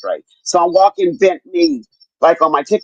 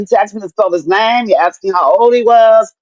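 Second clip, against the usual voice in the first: normal talking voice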